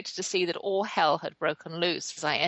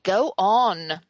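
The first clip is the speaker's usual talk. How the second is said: firm, louder order to a dog; raised-voice retold command to shoo; 7 dB louder than usual. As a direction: send the stray dog packing, loudly. Telling a stray dog off.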